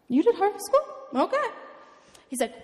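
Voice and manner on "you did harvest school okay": high, louder astonished question; retold amazement, rising sharply at the end; incredulous. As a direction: echo it back in disbelief. Acts out her disbelief at pastor.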